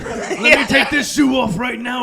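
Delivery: loud, raspy wrestler promo yell; Ultimate Warrior impression. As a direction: roar it like a wrestling hero. Hulk Hogan style wrestling promo.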